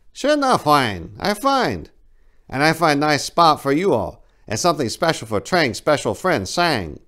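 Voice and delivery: high, loud broken English; high Trang voice. Acted. Voiced character Trang speaking.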